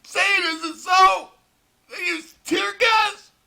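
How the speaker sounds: high, slow, hammed-up gasp of disbelief; mock shock. Mocking the news with fake high gasps. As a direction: overact horror at the news report.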